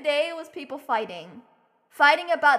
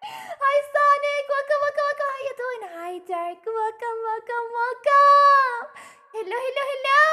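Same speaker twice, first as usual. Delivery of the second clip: excited greeting; 9 semitones higher, 4 dB louder than usual. Real, not acted. Streamer welcoming arriving viewers, excited greeting. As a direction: sing out the welcomes in a high voice.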